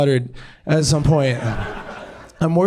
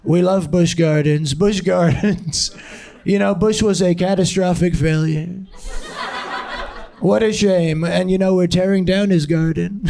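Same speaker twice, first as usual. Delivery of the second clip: hammed-up presidential impression, raised pitch; Trump; 3 semitones higher than usual. Comic plays president razing Busch Gardens, raised mock-politician voice. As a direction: pronounce it like a self-pleased politician mid-speech. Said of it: "Trump just".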